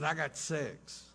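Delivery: whisper. Retold or imitated. Brother whispering back, gloating.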